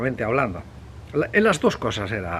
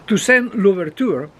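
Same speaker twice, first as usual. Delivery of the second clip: slow, higher, louder Spanish-accented French name; attempted French pronunciation; 8 semitones higher, 5 dB louder than usual. Real, not acted. Speaker doing their best after apologizing, attempted French pronunciation. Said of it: "es lo mejor que puedo hacer".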